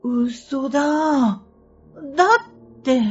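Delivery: insistent denial. Retold or imitated. Denial and disbelief exchange.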